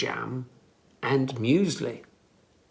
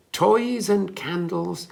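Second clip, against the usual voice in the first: usual speaking voice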